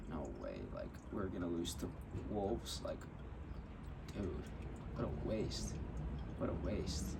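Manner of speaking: low, quiet, deflated grumble; dejected muttering. Retold or imitated. Fan to himself as his team trails late, dejected. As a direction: mutter it under your breath, resigned.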